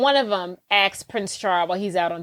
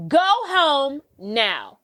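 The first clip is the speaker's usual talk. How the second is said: slow stern order; 4 semitones higher, 3 dB louder, much slower than usual. Retold or imitated. Hattie orders Ella home.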